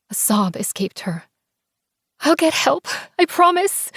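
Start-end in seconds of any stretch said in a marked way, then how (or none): 2.2-3.9 higher tearful promise from Ella; a sobbing girl swearing she'll return; tearful friend. Acted. Sobbing Ella answers her friend.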